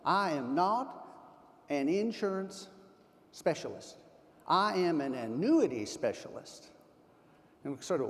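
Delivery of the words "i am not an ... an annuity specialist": slow Texan drawl with deliberate breaks; thick Texas drawl. Retold or imitated. Speaker imitating Charlie introducing himself to the audience, thick Texas drawl. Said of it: "he said in his thick Texas drawl".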